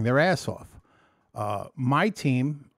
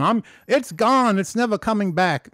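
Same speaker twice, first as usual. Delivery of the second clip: mock cocky boast; 9 semitones higher, 6 dB louder than usual. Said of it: "fucking march around".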